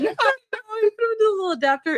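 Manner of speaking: high, fast and giggling through the words; laughing. Real, not acted. Laughing to her co-host about her dad's tiny headphones adapter. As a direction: laugh it out as you rush the line.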